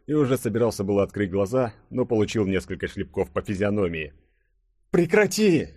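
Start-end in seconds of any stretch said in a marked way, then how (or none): 4.9-5.7 high-pitched whiny cry; wailing protest. A narrator's reading. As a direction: beg him to stop the slapping. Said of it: "запричитал Фадеев". (жалобный высокий вскрик)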